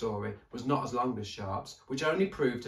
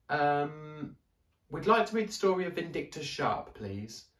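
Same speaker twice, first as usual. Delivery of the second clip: put-on higher, hesitant young boy; boy's voice; 6 semitones higher, 3 dB louder than usual. A narrator's reading. Daniel asking the librarian for a story, higher hesitant boy. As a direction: ask politely, unsure of himself.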